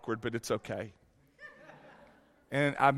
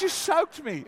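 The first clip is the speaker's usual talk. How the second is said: high-pitched imitation of an indignant wife; mock accusation in a high wife voice; 7 semitones higher than usual. Wife accusing husband in the wet bed, indignant. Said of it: "She goes".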